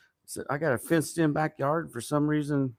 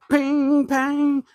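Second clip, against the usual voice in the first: loud high ping pang; 12 semitones higher, 9 dB louder than usual. Retold or imitated. Describing tendons popping, loud high ping pang.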